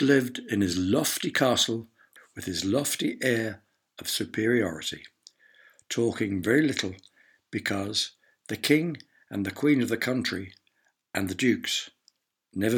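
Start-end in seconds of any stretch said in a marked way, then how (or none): none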